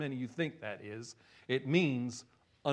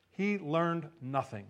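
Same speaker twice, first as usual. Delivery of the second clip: ordinary talk, nothing marked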